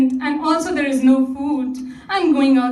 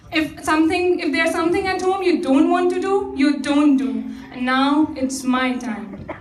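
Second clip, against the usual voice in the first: ordinary delivery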